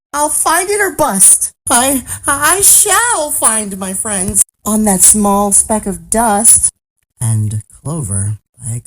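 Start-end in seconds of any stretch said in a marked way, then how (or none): 0.1-6.7 determined higher elephant voice; crying out. A narrator's reading. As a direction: vow it earnestly, starting high. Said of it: "cried Horton".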